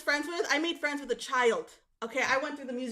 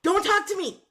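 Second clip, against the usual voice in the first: high indignant rant; 7 semitones higher than usual. Retold or imitated. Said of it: "And I'm always like".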